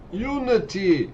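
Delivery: high drawn-out cheer; mock shout. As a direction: call it out like a slogan.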